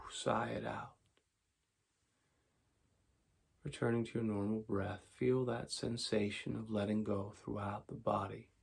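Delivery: calm, unhurried meditation voice with long pauses; guided meditation. Acted. Teacher guiding tension and relaxation before sleep, calm meditation voice. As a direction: talk them softly into letting go.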